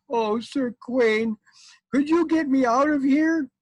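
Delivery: high mock-tearful plea; pleading, crying wife. Trapped wife asks Sir Quain for rescue, mock-tearful pleading. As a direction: beg in a loud, high put-on voice. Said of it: "And she was crying".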